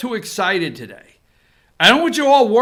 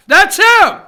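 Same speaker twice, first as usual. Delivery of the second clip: high, loud that's him; excited reveal; 10 semitones higher, 10 dB louder than usual. Real, not acted. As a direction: announce it like a big reveal.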